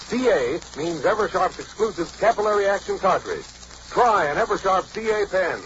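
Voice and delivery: ordinary talk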